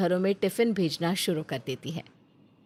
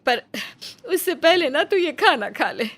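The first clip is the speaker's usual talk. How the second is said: slightly higher, tender mother urging her son to eat; mother's gentle coaxing voice; 4 semitones higher than usual. Acted. Mother Nandita coaxing her hungry son to eat, tender. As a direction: coax him like a caring mother who knows he's hungry.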